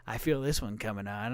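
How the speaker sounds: sly low mutter. Retold or imitated. Sly thought before farting.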